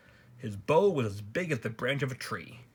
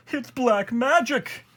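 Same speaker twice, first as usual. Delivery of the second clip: high, loud, drawn-out shriek; horrified shriek; 13 semitones higher, 7 dB louder, slower than usual. A narrator's reading. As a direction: shriek it in alarm. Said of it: "he shrieked".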